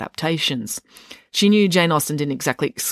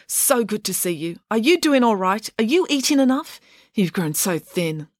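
a hint of a fussing mother voice; lightly voiced doting mum; 6 semitones higher than usual. A narrator's reading. Mum fusses over Dan.

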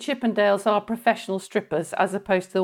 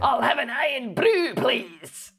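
put-on Glaswegian, pitched up at first; Glaswegian accent; 3 semitones higher, slower than usual. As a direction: order like a broad Glaswegian. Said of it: "if you're talking Glaswegian".

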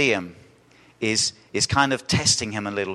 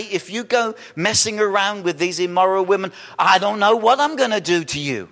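mock vague threat; 7 semitones higher, 4 dB louder than usual.

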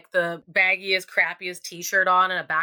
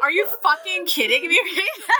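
high-pitched mock disbelief, laughter behind it; incredulous outburst; 8 semitones higher than usual. Acted disbelief at date's answer.

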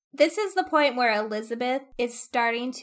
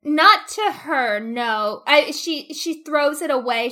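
ordinary delivery

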